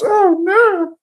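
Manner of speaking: high, loud wail of dismay; anguished oh no. Acted. Isaac realising he blessed the wrong son, wailing. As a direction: cry it out in horror.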